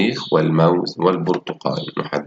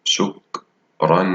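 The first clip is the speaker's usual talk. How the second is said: slow drawn-out reading; much slower than usual. Shown as a demonstration. Teacher models the underlined word, very slow. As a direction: stretch out شكرًا slowly, leaning on the final ن sound of شكرًا. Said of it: "أقرأ الكلمة التي تحتها خط".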